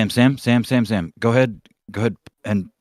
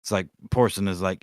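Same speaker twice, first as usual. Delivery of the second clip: ordinary delivery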